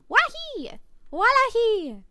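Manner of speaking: high, loud mock exclamation; sudden high-pitched playful cry; playful whoop.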